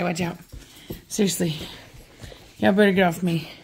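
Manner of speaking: usual speaking voice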